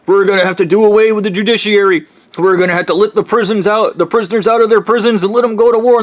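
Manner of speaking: a hint of a frantic pompous official; mock government official. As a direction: rattle off decrees like a crazed bureaucrat. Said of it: "pretending to be different branches of the government".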